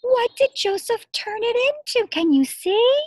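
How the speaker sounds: high, loud eager questions; excited reveal. Acted. Teacher asking children what Joseph made. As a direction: draw the kids in with excitement.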